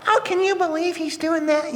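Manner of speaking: very high put-on gossipy voice; mock-outraged church lady, way up high; scandalized church lady. Church lady seeing a neighbor mow on Sunday, scandalized. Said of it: "just to get the church lady".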